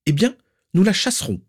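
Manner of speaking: high, sharp dismissal; dismissive retort. A narrator's reading. Aunt rejects Narcissa's plea, dismissive. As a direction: answer curtly, unmoved by the plea. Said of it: "répondit Valburga sans prendre la peine de se retourner".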